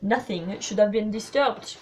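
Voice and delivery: mild scolding; reproachful. A narrator's reading. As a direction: reprove firmly, in careful foreign English. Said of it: "said Poirot reproachfully".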